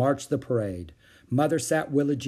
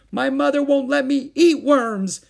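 very high, loud, drawn-out protest; shouting little boy; 9 dB louder than usual. A narrator's reading. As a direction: shout it in a small child's voice. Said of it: "shouted a little boy".

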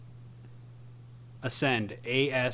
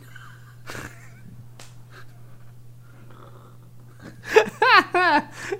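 high, breathless laughing fit; helpless laughter; 3 dB louder than usual. Real, not acted. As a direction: crack up until it squeaks. Laughing at the spelling.

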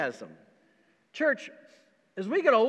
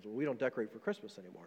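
put-on glum older man; 9 semitones lower, 12 dB quieter, faster than usual. Church elder telling the pastor he skips Christmas, low and glum. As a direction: lower and softer, flatly dismissive. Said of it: "the guy says".

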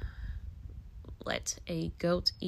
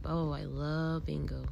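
ordinary delivery

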